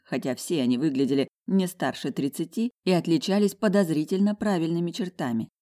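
usual speaking voice

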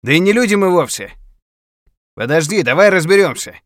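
loud, high pleading yell; shouting. Acted. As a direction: call out to be heard far off. Shouting to hidden attacker.

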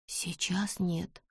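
slow breathy whisper; scared whisper. Acted. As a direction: hush it, afraid. Bess insists in fear.

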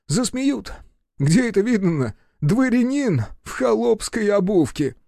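high-pitched aggrieved nobleman; indignant baron. A narrator's reading. Baron refusing peasant bast shoes, indignant. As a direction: protest in a high, offended voice. Барон возмущён лаптями.

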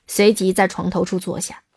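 ordinary delivery

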